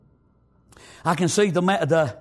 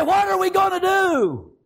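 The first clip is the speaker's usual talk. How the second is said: high shouted question; panicked shout; 11 semitones higher than usual. Acted. Servant shouts to his master in panic. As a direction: cry it out in fear.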